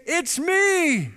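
high, excited little reveal; high mock joy; mock triumphant. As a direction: squeal it out like a grand surprise. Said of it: "But he doesn't say".

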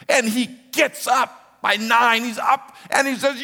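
high, loud, triumphant storytelling; climactic excitement. Real, not acted. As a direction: build to the big moment at full voice. Climax of the fight retold.

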